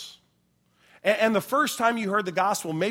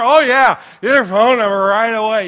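higher, much louder dazed enthusiasm; loud eager agreement; 5 semitones higher, 15 dB louder than usual. Retold or imitated. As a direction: shout yes like a drugged, overeager kid. Drugged son eagerly agreeing.

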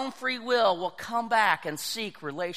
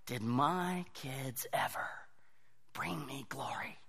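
whisper; 9 semitones lower, 15 dB quieter than usual. Real, not acted. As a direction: lean in and breathe the question. Reflective aside to congregation.

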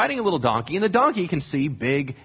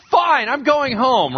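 huffy give-up; 7 semitones higher, 4 dB louder, slower than usual. Retold or imitated. King gives up in a huff.

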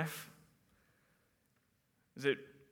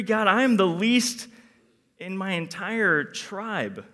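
higher, louder, anxious whiny protest; pleading Gideon; 6 semitones higher, 9 dB louder than usual. Acted. Gideon protesting God's call, fretful pleading. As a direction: beg God to see how weak you are.

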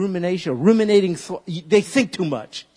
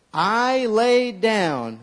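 slow, drawn-out memory verse; deliberate call-and-repeat line; slow recitation; 5 semitones higher, 3 dB louder, much slower than usual. Shown as a demonstration. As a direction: lead the congregation word by word. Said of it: "Say that with me".

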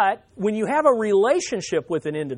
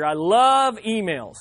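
slow, drawn-out mock adoration; sarcastic; 3 dB louder, slower than usual. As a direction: stretch LOVE with heavy sarcasm. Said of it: "I'm being sarcastic here".

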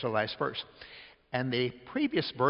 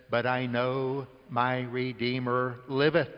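slow scripture reading; slower than usual. Acted. Preacher quoting scripture slowly.

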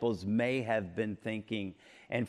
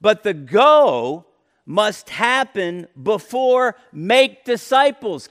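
"go" drawn out slowly and louder; stress on go; 7 semitones higher, 10 dB louder, slower than usual. Shown as a demonstration. Preacher explaining word order, slow stress on "go". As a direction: lean slowly on "go". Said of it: "the emphasis is on".

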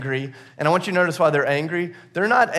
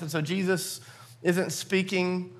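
usual speaking voice

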